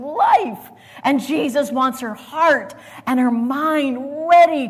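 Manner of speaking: slow, loud, rising pulpit preaching; preaching at full force. Acted. Preacher pressing his congregation toward faith in life. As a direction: lean into each word like a revival preacher.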